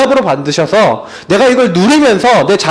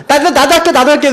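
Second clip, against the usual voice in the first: put-on child begging; 7 semitones higher than usual. Child's eager plea retold.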